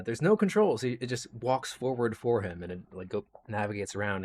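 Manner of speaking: usual speaking voice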